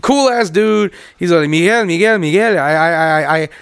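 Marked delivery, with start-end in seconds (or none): 1.5-3.5 imitated Puerto Rican accent, repetitive and halting; stammering impression in a Puerto Rican accent; Harry. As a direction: mimic a Puerto Rican man's accented English. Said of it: "the old Puerto Rican dude".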